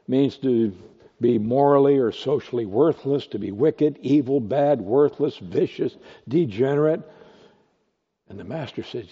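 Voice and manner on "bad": ordinary talk, nothing marked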